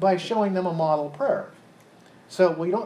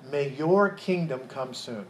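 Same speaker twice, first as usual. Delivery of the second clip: YOUR lifted and held in a slow line; stress on YOUR; 2 semitones lower, slower than usual. Shown as a demonstration. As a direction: lean slowly on "your". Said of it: "What's the emphasis there?".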